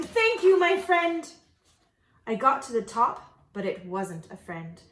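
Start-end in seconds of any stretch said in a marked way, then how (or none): 0.0-1.3 higher, louder grateful call; eager called thanks. Acted. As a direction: call up the rope.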